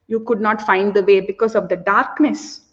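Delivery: usual speaking voice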